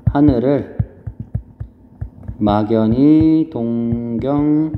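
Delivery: very slow, syllables stretched out; writing-along dictation. Real, not acted. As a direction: draw out each phrase while writing it down. Writes answer while saying it. (받아쓰듯 음절을 길게 늘여 천천히)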